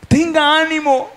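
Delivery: high, rising call to the neighbour; urged encouragement. Shown as a demonstration. Preacher urging church to cheer each other, lifted pitch. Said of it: "dígale".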